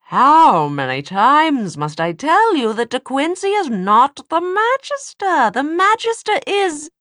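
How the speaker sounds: high, loud, enraged demon; furious demon shout. A narrator's reading.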